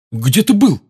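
loud, demanding question. A narrator's reading. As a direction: insist on an answer. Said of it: "требовательно спросил Рон".

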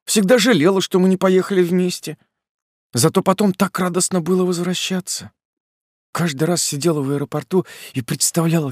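lightly voiced young woman; female character voice. A narrator's reading. Polina's long speech.

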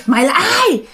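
high-pitched angry demand from a ghost; menacing female ghost, voice pitched right up; fierce ghost shriek. Retold or imitated. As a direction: wail the questions high and fierce. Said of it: "Mà nó gào, nó dữ, nó nói".

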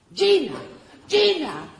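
high, loud call of the name; calling out to daughter. Acted. Elide calls her daughter Gina every morning, high loud call. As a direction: call her from the other room.

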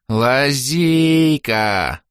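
slow bubbling alien cry, stretched out; gurgling tentacle alien. Acted. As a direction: gurgle the word from a puddle. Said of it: "пробулькал из лужи Орбит".

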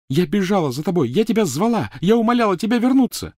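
high, loud, building outcry; escalating high-pitched reproach; anguished accusation. Acted. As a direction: cry it out with furious hurt. Hermione accusing Ron in rage.

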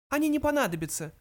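high little mouse voice; high-pitched interjection; mouse butting in. A narrator's reading. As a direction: cut in as a tiny mouse. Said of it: "вмешался Фрэнки".

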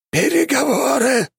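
very high, piercing eldritch shriek; inhuman voice. A narrator's reading. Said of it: "повторила фигура".

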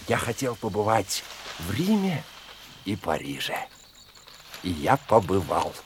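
low, soft confiding recollection; quiet reminiscing. Acted. Man tells doctor his youthful dreams, quiet reminiscing. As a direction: drop low and soft, savouring the memory.

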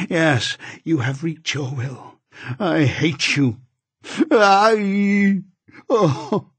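slow and bitter, rising to a high cry; screaming. A narrator's reading. Delirious dying wife to husband, building to a scream. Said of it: "she screamed".

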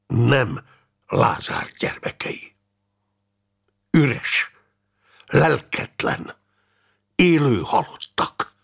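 halting, coldly emphatic; frosty, deliberate pronouncement; icy. Acted. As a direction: drop each phrase separately, with long pauses.

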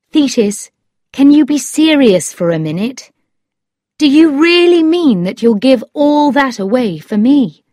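emphatic storybook suitor; Lord Struve, earnest. A narrator's reading. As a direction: press her to be serious.

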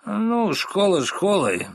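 pouncing attack. Acted. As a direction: pounce on her with the question. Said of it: "накинулся на неё мистер Корнер". (медленно и напористо набрасывается)

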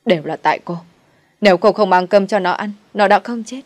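loud, raging blame; furious accusation. Acted. Grieving mother-in-law blames the widow for her son's death, furious shouting. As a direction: hurl the blame at her in a rage. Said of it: "nổi điên ... rồi chửi".

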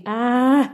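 loud high yelp of pain; sharp pain cry. Acted. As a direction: cry out as the spirit burns the wound. Wound doused with spirit.

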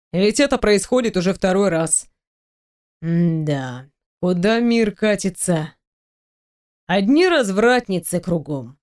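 low, mock-regretful man's voice; feigned regret. A narrator's reading. Boss teasing employee about indecent thoughts, feigned regret. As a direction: lament it low with put-on disappointment. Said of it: "Изобразил он сожаление".

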